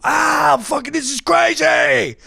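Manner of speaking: high overreacting shout; fake pain shout. Shown as a demonstration. Boxer faking agony for the coach, high shout. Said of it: "So I do that too".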